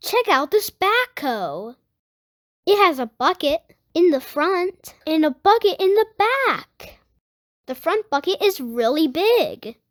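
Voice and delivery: lively picture-book read-aloud; reading to children. Acted. Host reading a Blippi book to young viewers, lively read-aloud. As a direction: read it out to little kids, bright and clear.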